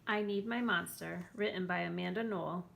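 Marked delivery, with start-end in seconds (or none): none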